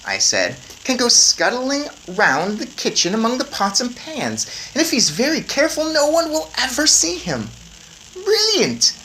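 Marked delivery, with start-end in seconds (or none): 0.8-7.5 put-on lightly higher boy voice; boy mouse. A narrator's reading. Boy reminding grandmother, light eager boy voice. 8.1-8.9 high, loud delighted cry; cried out. A narrator's reading. Grandmother thrilled by the plan, loud high cry. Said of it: "my grandmother cried out".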